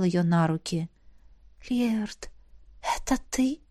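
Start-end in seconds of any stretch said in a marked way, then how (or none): 1.7-3.6 soft, half-asleep question; sleepy murmur. A narrator's reading. Sleeping girl asks who carries her, sleepy murmur. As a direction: ask it softly from sleep. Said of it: "Захухря сонно пошевелилась".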